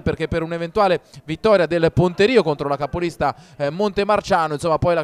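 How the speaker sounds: usual speaking voice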